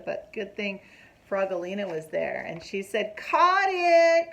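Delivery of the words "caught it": high, loud proud catch; triumphant cry. Acted. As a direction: call out triumphantly. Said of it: "cried Frogalina".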